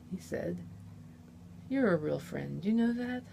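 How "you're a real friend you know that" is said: ordinary delivery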